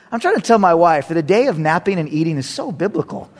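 ordinary delivery

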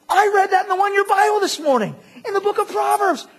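loud, high-pitched burst of discovery; amazed realization. Acted. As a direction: exclaim it as a sudden thrilling discovery. Acted amazed reaction to reading.

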